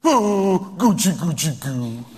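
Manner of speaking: exaggerated coochy-coo babble; mock baby talk. Gal talking to her husband, mock baby talk. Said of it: "that's how this gal is talking to her husband".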